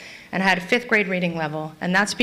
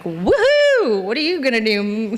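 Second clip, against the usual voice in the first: party whoop. Acted. Drunk driver shrugging off her first DUI, carefree whoop.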